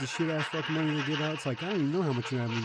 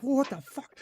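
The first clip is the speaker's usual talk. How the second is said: high-pitched mock alarm; imitated startled cops; 10 semitones higher than usual. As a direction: react like a startled cop. Cops' retold shock.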